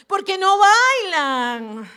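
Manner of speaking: queja alargada y muy fuerte; queja burlona. Retold or imitated. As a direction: alarga las vocales con fastidio exagerado. Se burla de su aburrimiento pasado. (queja burlona, arrastrada y fuerte)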